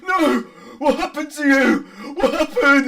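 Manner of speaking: high, loud grief-stricken wail; distraught father's shout; anguished screaming. Acted. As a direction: cry out in horror at your dead children. Father crying out over dead children.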